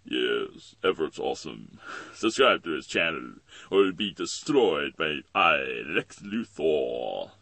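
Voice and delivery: loud, low, slow supervillain proclamation; Lex Luthor. Acted. Villain ordering viewers to subscribe or be destroyed, loud and grandiose. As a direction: threaten grandly, stretching the final words. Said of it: "I fucking love that Lex Luthor".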